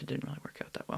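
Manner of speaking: soft unvoiced aside; whispering. Real, not acted. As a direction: whisper it wryly. Wry aside about failure.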